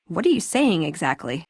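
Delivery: ordinary delivery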